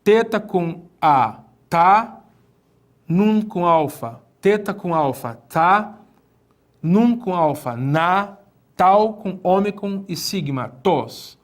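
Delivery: syllable by syllable, slow; teacher spelling Greek letters slowly; slow read-along. Shown as a demonstration. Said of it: "Então, junta tudo".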